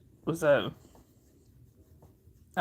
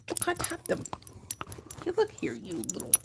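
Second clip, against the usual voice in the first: much higher, softer pet voice; 11 semitones higher, 7 dB quieter than usual. Real, not acted. Owner squeaks at the dog chewing her fingers. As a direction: squeak it at the puppy.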